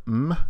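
"m" hummed alone as a consonant; isolated m sound. Shown as a demonstration. Teacher showing the sound of mu, isolated m sound. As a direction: sound out "m", not the letter name. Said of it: "the M sound".

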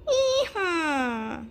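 high loud hee-haw; donkey bray. Acted. Narrator voicing the donkey in the story, braying. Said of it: "The donkey said".

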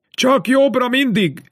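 calling out. A narrator's reading. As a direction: call it out slowly to someone leaving. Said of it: "kiáltott neki Kaderus".

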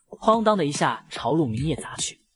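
plain reading voice

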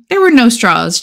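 plain reading voice

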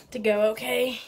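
ordinary talk

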